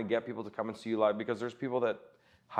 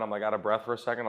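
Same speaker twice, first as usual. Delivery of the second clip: ordinary talk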